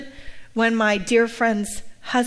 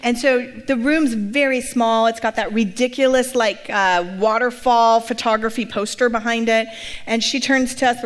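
ordinary talk

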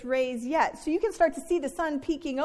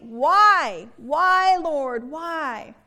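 loud, aching cry of why; anguished complaint; 7 dB louder, much slower than usual. Acted. Moses complaining to God, a loud anguished why. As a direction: throw the why at heaven in anguish. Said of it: "You can hear the complaint".

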